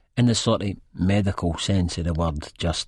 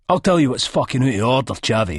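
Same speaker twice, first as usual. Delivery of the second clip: loud, fast, higher-pitched comeback; angry retort; 4 semitones higher, 5 dB louder, faster than usual. A narrator's reading. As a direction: fire back aggressively. Angry retort in acted quarrel.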